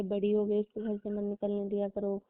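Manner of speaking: ordinary talk, nothing marked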